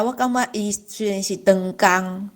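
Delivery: usual speaking voice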